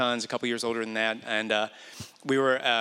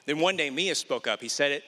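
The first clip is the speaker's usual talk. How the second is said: normal talking voice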